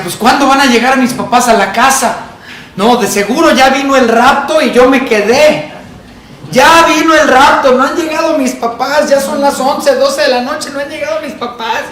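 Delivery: put-on high, rising childish panic, half laughing; panicky child. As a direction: play the scared kid waiting up alone. Niño solo imitando su pánico. (niño asustado, voz aguda y temerosa)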